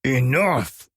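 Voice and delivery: high, loud shout through gritted teeth. A narrator's reading. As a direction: cut them off hard. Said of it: "I shouted through gritted teeth".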